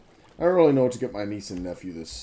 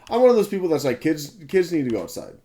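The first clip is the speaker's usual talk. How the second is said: emphatic rant; 8 semitones higher, 8 dB louder than usual. Real, not acted. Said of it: "I am one of those people".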